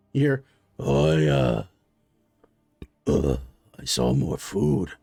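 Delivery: low hungry monster voice; deep reptilian brute. Acted. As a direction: drop way down into a lumbering beast.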